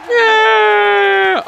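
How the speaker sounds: high long victory shout; triumphant yell. Real, not acted. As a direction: hold the cheer loud and high. Celebrating again.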